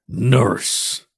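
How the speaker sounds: derisive. A narrator's reading. Stepfather mocking his stepson's profession, derisive. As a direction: spit out "nurse" with open derision. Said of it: "the derision in his voice".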